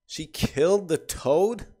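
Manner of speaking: can't believe what he just read; real shock over the toad; stunned disbelief. Real, not acted.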